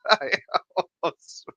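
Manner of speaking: usual speaking voice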